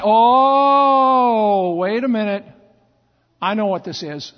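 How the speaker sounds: loud, drawn-out dawning oh; sudden realization. Acted. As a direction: catch yourself as it dawns on you. Eli realizes it's God.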